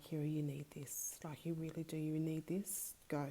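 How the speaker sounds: soft, whispered encouragement from her husband; hushed husband quote. Retold or imitated. Husband urging Kira to take the retreat, whispered. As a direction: breathe his words to her, gently insistent.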